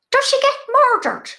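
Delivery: put-on high, loud, eager question in an elderly mother's voice; high mammy voice. Her mother asking about the show, high and eager. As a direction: ask eagerly, pitched well up like an old mum. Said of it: "And she goes".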